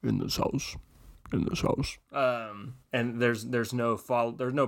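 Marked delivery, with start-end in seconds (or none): 0.0-2.0 a low creepy voice fading to a whisper; a hint of a horror whisper; mild eerie trailer voice. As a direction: imitate the spooky trailer lightly. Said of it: "they just keep saying".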